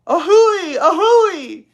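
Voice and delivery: very high, loud train whistle; locomotive wail. Acted. Little girl wailing like a train whistle. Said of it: "wailed Ramona".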